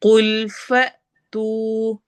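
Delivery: slow, drawn-out tajweed chant; Quran recitation. Shown as a demonstration. Teacher recites verse for students, slow tajweed chant. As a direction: recite it slowly, stretching each sound. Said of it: "फ झटका देना है".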